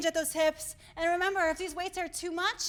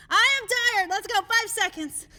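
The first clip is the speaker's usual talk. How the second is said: higher, louder tired exclaim; 6 semitones higher, 8 dB louder than usual. Real, not acted. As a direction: belt it out. Tired instructor repeats herself.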